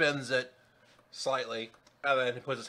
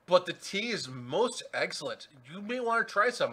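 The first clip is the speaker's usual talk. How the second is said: Mad Hatter; 4 semitones higher than usual. Acted. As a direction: offer it grandly, drawing the words out. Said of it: "Mad Hatter turns and looks at, at Zabe guy and goes".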